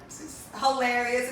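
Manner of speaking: ordinary talk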